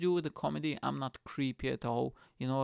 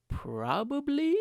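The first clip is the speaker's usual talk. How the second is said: drawn out; 12 semitones higher, 5 dB louder, much slower than usual. Real, not acted. Drawn-out comic admission.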